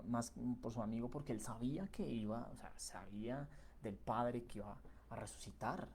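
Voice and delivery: ordinary talk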